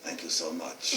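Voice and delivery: low, very soft imitated Russian accent; strong Russian accent. Grateful teacher to visiting author, strong Russian accent. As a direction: murmur it in a thick Russian accent. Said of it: "A very strong Russian accent".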